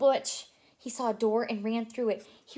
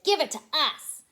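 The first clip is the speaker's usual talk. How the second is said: demanding; 5 semitones higher, 7 dB louder than usual. Acted. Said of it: "they said".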